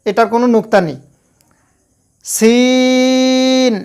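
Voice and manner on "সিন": সিন held long with a thin hiss; drawn-out thin seen. Shown as a demonstration. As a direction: stretch সিন lightly at the teeth. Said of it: "এটা পাতলা". (পাতলা করে টেনে সিন)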